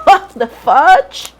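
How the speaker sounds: amused laughing outburst. Real, not acted. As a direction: laugh through the line in disbelief. Laughing at her own burp talk.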